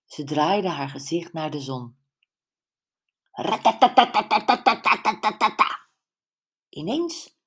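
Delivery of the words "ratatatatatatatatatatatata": loud rapid rattling noise of helicopter blades; imitated helicopter rattle. A narrator's reading. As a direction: rattle it out like rotors overhead. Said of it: "klonk er hard geratel".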